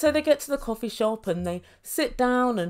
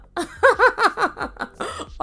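loud laugh; 10 semitones higher, 5 dB louder than usual. Real, not acted. Laughing out loud at Asuelu's pizza answer. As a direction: burst of high loud laughter.

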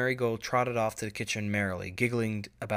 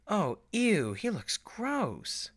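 put-on high, drawn-out "ew" of a grossed-out young pony; disgusted filly; 10 semitones higher, much slower than usual. Busy Bee reacting to a man's photo on TV, grossed-out high voice. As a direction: make the "gross" stretch out slowly.

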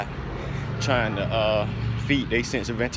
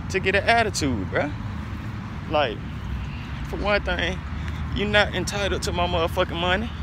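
worked up, much higher pitch; heated rant; 7 semitones higher than usual. Real, not acted. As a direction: vent your irritation, voice rising. Ranting about entitled panhandlers.

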